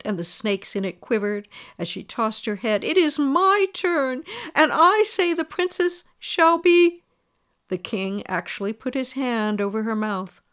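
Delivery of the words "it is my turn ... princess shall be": high, loud and slow; furious insistence. A narrator's reading. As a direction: hammer home her claim. Fairy insists, furious, after outburst.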